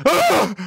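high strained gasping ah; mock heart-attack cry. Man faking a heart-attack cry so his wife wakes, high. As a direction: gasp out a cry as if clutching the chest. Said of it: "You know, just a".

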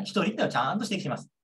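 normal talking voice

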